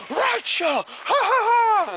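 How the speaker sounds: high shouted Rancho battle cry; excited shout. Retold or imitated. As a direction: yell it with full energy. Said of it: "We were like".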